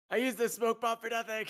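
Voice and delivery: high loud laughing outburst. Real, not acted. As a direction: shout it while laughing.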